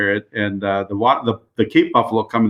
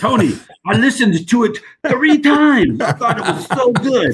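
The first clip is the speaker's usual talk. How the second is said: higher, slow South African accent imitation; 12 semitones higher, slower than usual. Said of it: "Is that a South African accent?".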